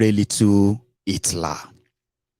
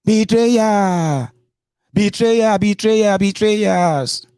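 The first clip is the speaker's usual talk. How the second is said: high-pitched mock scream of accusation; imitated screaming; 12 semitones higher, 4 dB louder, much slower than usual. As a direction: cry it out like a raging politician. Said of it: "He is screaming".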